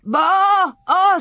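high, loud, drawn-out animal bawl; bawling calf. A narrator's reading. Star bawls as the calves bolt, loud animal cry. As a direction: bellow like a frightened calf.

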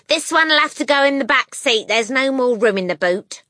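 loud, brisk, common-sounding wife; harried mother voice. A narrator's reading. Wife tells husband about the packing, harried and loud. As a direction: complain to the husband while packing.

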